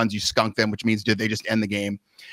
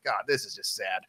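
much softer, lower muttered pity; pitying aside; 5 semitones lower, 11 dB quieter than usual. Retold or imitated. Pitcher thinking about the weak batter, quiet pity. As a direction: say it under your breath, feeling sorry. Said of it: "and I'm like".

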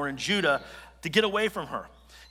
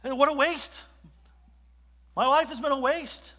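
much higher whiny lament; mock despair; 9 semitones higher than usual. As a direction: wail it like a failure. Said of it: "he's just looking at it saying".

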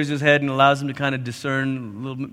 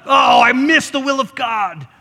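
mock despairing cry; 8 semitones higher, 5 dB louder than usual. Client loss lament.

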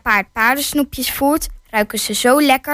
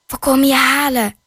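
normal talking voice

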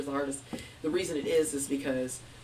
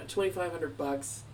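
ordinary delivery

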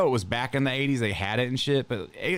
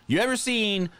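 ordinary talk

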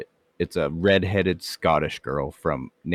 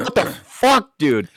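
shocked outburst; 8 semitones higher, slower than usual. Retold or imitated. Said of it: "I'm like".

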